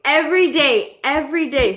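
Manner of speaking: ordinary talk, nothing marked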